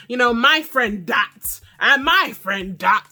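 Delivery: loud, drawn-out mock outrage; acted indignation. Vlogger imitating Chioma defending her friend, mock indignant.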